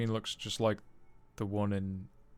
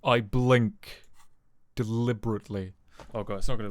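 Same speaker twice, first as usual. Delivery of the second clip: loud, nervous and jumpy; scared horror game streamer; 8 dB louder than usual. Real, not acted. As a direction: brace for a jump scare.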